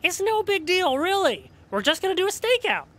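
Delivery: high, quick forced breeziness; nervous downplaying. Friend nervously pitching a stakeout as no big deal. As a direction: talk it down fast, too brightly.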